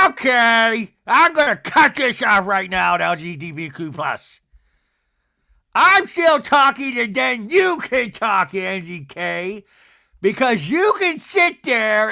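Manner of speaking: loud, slow mock outburst; hammed-up imperious shouting; Meredith impression. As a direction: talk over her in a drawn-out yell. Mimics Meredith cutting Angie off.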